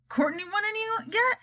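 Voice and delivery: high, playful punchline; joke punchline. Acted. President delivers the punchline, high and playful. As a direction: land the pun brightly. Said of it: "said the president".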